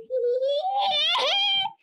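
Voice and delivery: high-pitched squealing giggle. Real, not acted. Player laughing at their odd new voice, high giggle. Plays around with changed voice.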